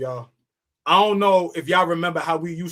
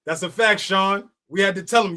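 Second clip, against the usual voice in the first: ordinary talk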